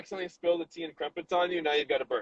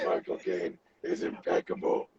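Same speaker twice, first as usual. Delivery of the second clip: low, slow Cockney Michael Caine voice; a breathy mock Michael Caine, drawn out; Michael Caine impression; 11 semitones lower, 4 dB quieter than usual. To a friend, showing off a better Michael Caine impression, low and slow. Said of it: "a better Michael Caine impression".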